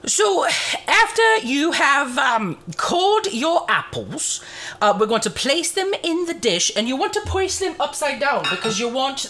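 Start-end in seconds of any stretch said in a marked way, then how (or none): none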